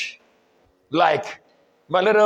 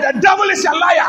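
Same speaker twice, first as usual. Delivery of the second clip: higher, more forceful declaration; raised preacher's voice driving the point home; emphatic preaching repeat; 4 semitones higher, 4 dB louder than usual. Real, not acted. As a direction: insist on it to the congregation.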